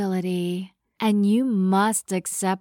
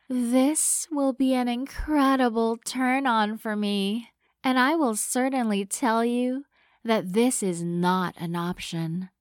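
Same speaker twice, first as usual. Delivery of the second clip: ordinary delivery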